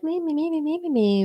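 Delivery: mock the trolls as whining babies; whiny baby. Mocking online trolls as whiny babies, high whine. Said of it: "whiny babies about it".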